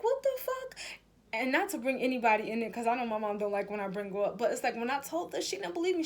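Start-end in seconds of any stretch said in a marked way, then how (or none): 0.0-0.8 much higher squeaky mock shock; hammed-up shocked squeal; shocked disbelief. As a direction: shoot it up high in disbelief. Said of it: "I'm like".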